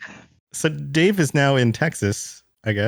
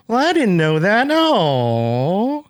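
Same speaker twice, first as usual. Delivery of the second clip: "No" swooping through a wide run of notes; imitated lyrical lilt, high, with "No" sliding down and back up; sing-song swoop, "know that" and "No" stretched across an octave; whole scale of notes; 7 semitones higher, much slower than usual. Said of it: "ends with, like, an entire scale of notes".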